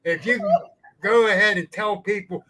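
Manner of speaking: retold heated telling-off at a cookout; angry telling-off. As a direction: tell him to sit down and shut up. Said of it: "He had me fired up".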